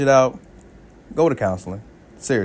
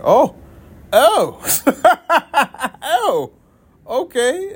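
higher, stunned stammering oh's into okay; stunned realization; 12 semitones higher, much slower than usual. Retold or imitated. Hearing the wife reveal the separation, stunned and laughing. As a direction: caught off guard, laughing it off.

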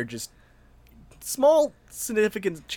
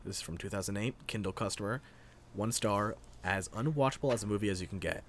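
much softer, rushed read; quietly; 3 semitones lower, 11 dB quieter than usual. Real, not acted. Reader rushing through a long review, soft and quick. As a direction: rattle it off under your breath. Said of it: "read it as fast as I can".

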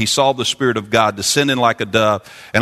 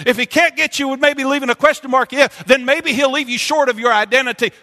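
preaching at full force; 12 semitones higher than usual. Acted. Preacher rising to full fervor about the enemy's lies. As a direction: drive it home to the congregation.

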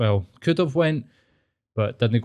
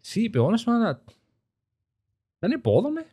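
much higher, airy mock nonchalance; casual brush-off; 11 semitones higher than usual. Telling his missus the crash didn't faze him, much higher and offhand. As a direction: toss it off lightly in a raised voice. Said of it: "And I was like".

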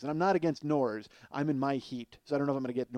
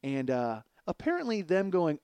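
ordinary delivery

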